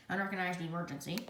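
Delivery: ordinary delivery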